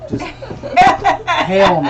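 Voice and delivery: slow drawn-out laughing no; laughing refusal. Pretend. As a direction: stretch it out through a laugh. Dad refusing party, laughing.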